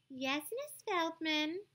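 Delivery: butter up the teacher, slowly and sweetly. A narrator's reading. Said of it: "she said sweetly".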